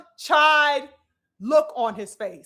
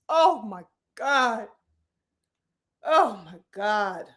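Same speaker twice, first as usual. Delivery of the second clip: stunned disbelief; 5 semitones lower, slower than usual. Real, not acted. Stunned at reaction.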